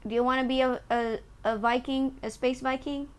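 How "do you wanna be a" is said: normal talking voice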